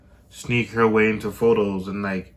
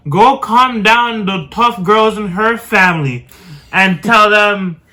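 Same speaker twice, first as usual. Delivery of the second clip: urgent messenger; 8 semitones higher, 7 dB louder, slower than usual. Retold or imitated. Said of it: "here comes someone else now".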